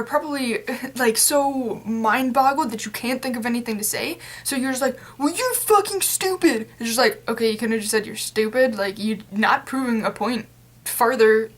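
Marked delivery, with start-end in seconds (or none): 5.2-6.7 mock angry insult. Imitating angry arguer.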